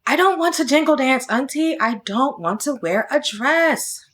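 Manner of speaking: fast, upset rush of words; all in a rush. Acted. Child blurting to her auntie, fast and upset. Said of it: "All in a rush".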